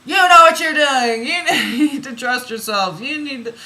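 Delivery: high, very loud scolding with laughter breaking in; mock yelling. Reader imitating the oracle yelling at you, mock shouting. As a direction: yell it like an insistent oracle, playfully overdone. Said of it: "She's yelling at you".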